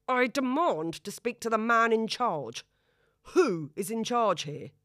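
loud demanding shout. A narrator's reading. Father shouts for the man in charge. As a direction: bellow down the corridor. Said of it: "he shouts again".

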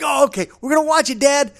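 imitating his son. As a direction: rattle it off like an eager boy. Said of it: "Gunner's like".

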